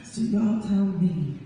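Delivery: slow, weighty final lines; slow closing question. Acted. Poet asking the crowd directly, slow and deliberate. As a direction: land each word slowly, then let it fade.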